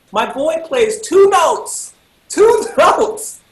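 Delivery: loud, high, drawn-out outrage; hammed-up cry of unfairness; indignant complaint. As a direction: stretch and hammer the count. Indignant that friend got in easily.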